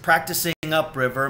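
ordinary delivery, nothing marked

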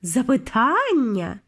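ordinary delivery

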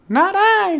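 very high tiny mouse squeak; squeaky mouse. A narrator's reading. The mouse refusing the hen, high squeaky voice. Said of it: "said the mouse".

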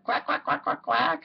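high quacking mother duck; a mother duck quacking happily to her ducklings; duck quacks. Acted. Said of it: "Mama Duck said".

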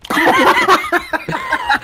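loud high burst of laughter. Real, not acted. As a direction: crack up loudly. Laughing at Mickey voice.

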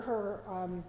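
ordinary delivery

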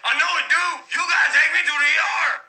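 panicked demand to go to the ER; frantic yelling. Acted. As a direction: shake him awake, shouting. Waking friend in a panic.

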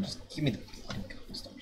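breathless whisper of frustration. Real, not acted. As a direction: repeat it as a voiceless whisper. Repeats demand, second whispered.

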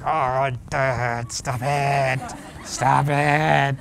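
drawn-out mock demonic groan; possessed whine. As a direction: moan at Dad as if possessed. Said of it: "you sound like you're possessed".

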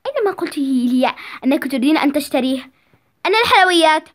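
loud, scolding father voice; a worried dad shouting at his late girl; angry father. Acted. Father scolding his late daughter, loud and angry.

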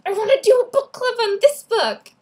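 high, loud, thrilled; gushing fan excitement; eager outburst. Retold or imitated. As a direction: squeal it like a fan who just decided. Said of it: "and I'm like".

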